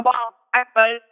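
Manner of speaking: normal talking voice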